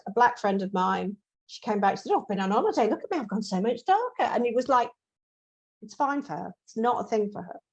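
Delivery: ordinary talk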